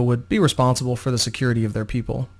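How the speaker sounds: usual speaking voice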